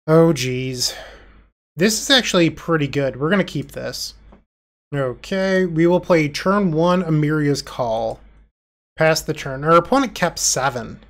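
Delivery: normal talking voice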